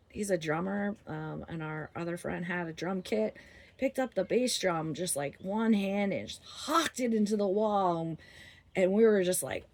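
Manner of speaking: ordinary talk, nothing marked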